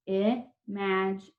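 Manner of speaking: IMAGINATION with pauses: ih, maj, in, ay, shun; dictated by syllable. Shown as a demonstration. Teacher dictating for pupils to write, IMAGINATION syllable by syllable. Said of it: "Go ahead and write that down".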